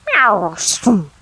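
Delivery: loud meow and hiss; cat noises. Acted. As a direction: yowl like a cat at night. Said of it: "strange, horrid noises".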